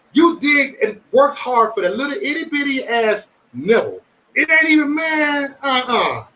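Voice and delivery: high-pitched, worked-up complaint; comic frustration. Retold or imitated. Griping about a little nibble of crab, comic frustration. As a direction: push the pitch up in comic exasperation.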